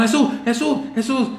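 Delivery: high, loud frantic calling; panicked cry. Acted. Disciples shaking Jesus awake, panicked loud calling. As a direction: wake him in a panic.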